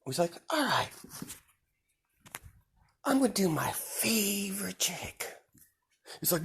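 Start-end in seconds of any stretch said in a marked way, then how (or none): 0.5-5.4 high, hushed musing; sinister whisper. Acted. Deku musing about cutting himself, whispered and creepy. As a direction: purr it half to yourself.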